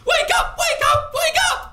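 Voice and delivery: very high, loud, gasping screech; shrieking old crone. Acted. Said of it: "shrieks out in a gasping voice".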